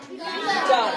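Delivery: usual speaking voice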